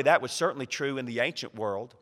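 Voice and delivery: normal talking voice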